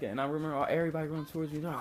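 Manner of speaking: normal talking voice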